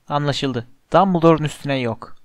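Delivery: ordinary delivery, nothing marked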